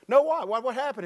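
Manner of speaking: sharp high insistent question; demanding what happened. Retold or imitated. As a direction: press the kid for an answer, rising alarm. Pastor demanding answer from boy.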